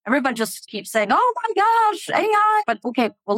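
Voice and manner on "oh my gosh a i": high, drawn-out mock alarm; mock screaming panic. CIO mimicking everyone's panic about AI, mock screaming. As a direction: imitate everyone freaking out, pitch way up. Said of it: "let's get past the screaming".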